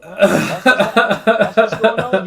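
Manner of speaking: loud, high, worked-up retort; loud, high incredulous outburst. Real, not acted. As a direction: raise it up in disbelief.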